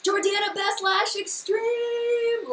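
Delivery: thrilled singsong cry; excited fangirl. Retold or imitated. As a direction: gush and sing the name out slowly. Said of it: "I was like".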